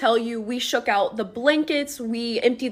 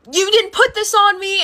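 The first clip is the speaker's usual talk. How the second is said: high, loud accusation; mother confronting daughter about the remote; accusing mom; 9 semitones higher, 5 dB louder than usual. Retold or imitated. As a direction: hold the remote up and demand the truth.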